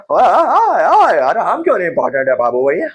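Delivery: hammed-up outraged yelling from the ignored atoms; indignant protest. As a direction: yell it like an offended little atom.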